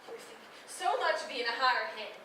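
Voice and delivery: ordinary talk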